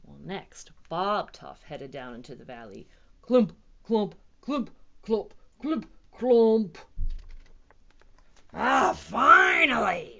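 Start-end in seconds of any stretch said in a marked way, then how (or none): 3.2-6.8 loud, heavy, separated stomps; giant footsteps. A narrator's reading. Big Bob heads into the valley, booming footsteps. 8.5-10.2 loud, drawn-out triumphant roar; T-Rex roar. A narrator's reading. T-Rex greets his long-awaited meal, roaring. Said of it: "roared the T-Rex".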